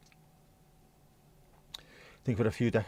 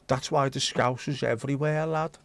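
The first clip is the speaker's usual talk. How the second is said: put-on Scouse accent. Mock Scouser on lapscouse.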